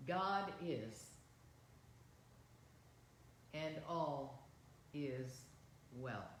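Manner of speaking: slow, measured prayer with long pauses. Real, not acted. Minister leading congregation in prayer of protection, slow prayer. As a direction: say it slowly, phrase by phrase, for others to repeat. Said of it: "I'll say it slowly".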